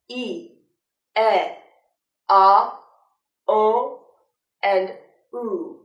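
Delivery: "E", "a", "o", "u" each held on one steady sound; pure vowels. Shown as a demonstration. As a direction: keep every vowel pure, no glide. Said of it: "But pure vowels are".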